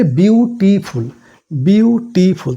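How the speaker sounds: "বিউটিফুল" drawn out in three beats, বিউ-টি-ফুল; syllable by syllable. Shown as a demonstration. Teacher has students count tongue movements, "বিউটিফুল" slowly in three syllables. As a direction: sound out "বিউটিফুল" slowly, each syllable separate. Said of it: "তোমরা count করো, দেখো তিনবার".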